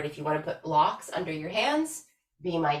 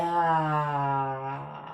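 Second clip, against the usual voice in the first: dramatic sigh; 3 semitones lower than usual. Shown as a demonstration. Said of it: "big sigh".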